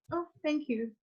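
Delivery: soft, offhand thank-you; murmured casual thanks, barely bothered; low-key thanks. Shown as a demonstration. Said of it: "So ordinary, so low".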